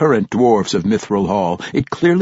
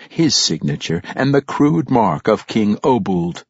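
usual speaking voice

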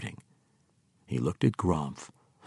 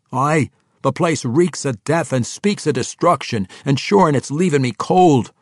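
raised, loud dwarvish brogue; grim dwarf king; 7 semitones higher, 8 dB louder than usual. Acted. Dwarf king agreeing about the orc fortress, loudly grim. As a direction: agree with force as a disgusted dwarf.

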